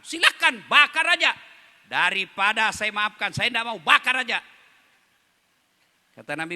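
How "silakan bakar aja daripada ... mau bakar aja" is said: high, loud defiant snap; bitter refusal. Acted. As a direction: dare him to burn her son. Angry mother refusing to forgive. (ibu menolak dengan marah, suara tinggi dan keras)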